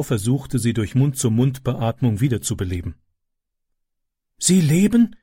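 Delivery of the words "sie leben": gasping. Acted. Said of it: "keuchte Ram".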